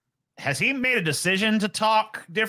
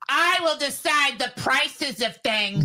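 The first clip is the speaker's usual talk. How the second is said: mock Kamala; 7 semitones higher, slower than usual. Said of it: "she's like".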